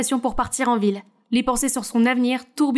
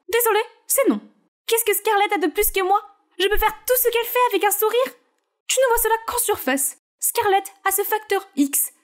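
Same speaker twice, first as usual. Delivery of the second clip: higher voices, whiny daughter versus dismissive mother; pleading teen and cold mother; 8 semitones higher than usual. Acted. Naomi begs her mother to make her famous, higher and whiny. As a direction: beg mom, then get shut down.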